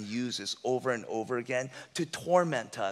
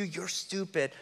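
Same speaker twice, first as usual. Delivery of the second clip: soft taunt; 4 semitones higher, 7 dB quieter, slower than usual. Retold or imitated. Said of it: "enemy whispering to you".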